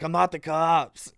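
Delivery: a hint of a cop at the door; mock trooper voice. Trooper calling through the door, lightly mocked. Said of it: "he's like".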